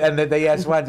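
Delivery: usual speaking voice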